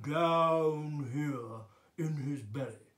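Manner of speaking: slow, drawn-out deep demo; deep belly voice. Shown as a demonstration. Teacher showing where the king talks from, deep voice. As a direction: let it sink down into the belly. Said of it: "has got a very deep voice".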